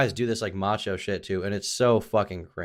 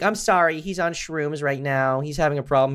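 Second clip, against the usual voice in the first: higher, pleading mock apology; imitated apologetic girlfriend; 5 semitones higher than usual. Girlfriend apologizing for him as she drags him, mock apologetic. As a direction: mimic a woman excusing her boyfriend. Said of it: "she's apologizing".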